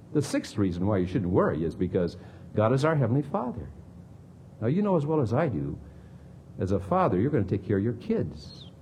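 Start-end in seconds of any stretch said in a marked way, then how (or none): none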